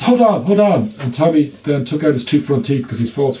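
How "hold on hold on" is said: high-pitched, retold "wait a moment" call; mock urgent halt. Practice manager stalling a patient about to hit him, mock urgent. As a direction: put a raised, urgent pitch on a retold interruption.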